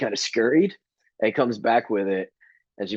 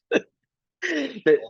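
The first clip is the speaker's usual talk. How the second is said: laughing; much slower than usual. Real, not acted. Laughing at the story.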